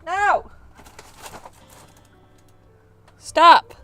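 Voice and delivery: loud, high commands; loud panicked shouting. Acted. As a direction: yell to stop the kid. Yelling at child to stop.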